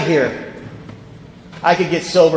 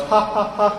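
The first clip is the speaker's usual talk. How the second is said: softer staccato mock laugh; a buddy's canned laugh, played small; imitated laugh; 5 dB quieter than usual. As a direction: put on three evenly spaced ha's. John's laugh repeated in story.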